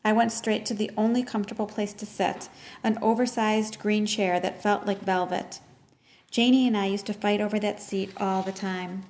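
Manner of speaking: usual speaking voice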